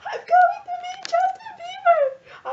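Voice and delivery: high, slow shriek of joy; ecstatic scream. Retold or imitated. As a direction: scream it like a dream came true. Screaming the news to everyone.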